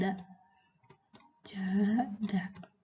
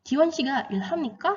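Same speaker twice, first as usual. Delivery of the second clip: "Jiwon Shiga Ilham Nika" higher, rising at the end; rising question intonation; 4 semitones higher than usual. Shown as a demonstration. Teacher asking whether Jiwon works, "Jiwon Shiga Ilham Nika" with rising intonation. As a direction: ask it with a lift on the final Nika. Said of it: "Kalau bertanya kan otomatis intonasinya naik".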